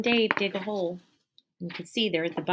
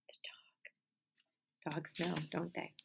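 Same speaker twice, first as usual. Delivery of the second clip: quiet secret whisper to the class; whispered hint; hushed aside; 14 dB quieter, slower than usual. Acted. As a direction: let them in on the secret.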